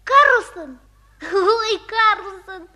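loud delighted surprise; surprised. Acted. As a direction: gasp out his name, thrilled. Boy surprised to see Karlsson.

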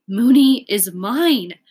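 put-on boy realizing the dog is his; joyful realization. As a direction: savour each word with joy. Sudden joy at the gift.

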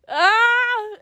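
short high yelp of embarrassment; quick high embarrassed cry; embarrassed squeal. Real, not acted. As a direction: squeal in mortified surprise.

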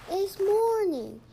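slow, high, loud announcement; emphatic protest. A narrator's reading. Patrick tells Granny the night is over, emphatic protest. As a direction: draw it out loudly as the punchline.

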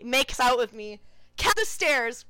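normal talking voice

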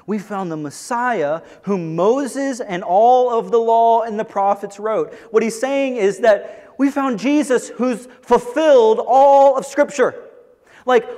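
fervent preaching. Acted. Pastor telling the congregation Jesus fulfills Scripture, fervent preaching. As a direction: preach it with mounting fire. Preaching builds to full force.

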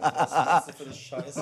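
slow, loud and laughing. Real, not acted. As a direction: laugh through the whole question. Laughing at the friend's groaning.

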